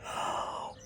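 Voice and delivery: a soft sharp intake of breath; quiet gasp. Acted. Ellie as Mum walks in, a quiet gasp. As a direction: catch your breath in alarm.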